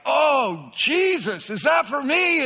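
high, loud cry of delighted surprise; overjoyed believer calling out to Jesus; excited amazement. Pretend. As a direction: shout it out, thrilled it might be for you. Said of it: "you're just like".

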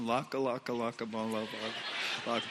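low, soft repetitive babble; meagre imitated tongues. Believer babbling beside his fluent partner, low imitated tongues. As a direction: drone a few nonsense syllables, trailing down. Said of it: "she's speaking in tongues, and I'm saying".

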